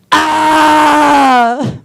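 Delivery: long, high, sustained "ah"; imitated drawn-out cry of pleasure; loud mock moan.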